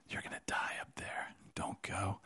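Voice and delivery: enemy's hushed whisper. Retold or imitated. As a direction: breathe it like a sinister taunt.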